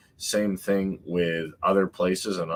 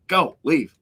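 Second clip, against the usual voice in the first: mock guard ordering; 10 semitones higher, 5 dB louder than usual. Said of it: "a guy with a stopwatch".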